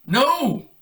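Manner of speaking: high, loud forbidding shout; sharp command. Acted. Brian shouting at his kits to stop. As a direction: stop them cold.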